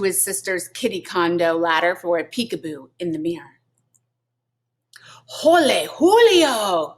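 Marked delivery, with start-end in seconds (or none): 5.4-7.0 high, slow, louder squeal of delight. A narrator's reading. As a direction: squeal like an excited kitten. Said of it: "squealed the kitty boy".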